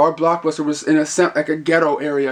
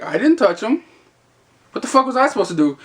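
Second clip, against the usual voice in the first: higher, retold protest of innocence; indignant self-defence; 7 semitones higher than usual. Clerk blamed for customers' pinching, indignant protest. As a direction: plead the case in mock disbelief.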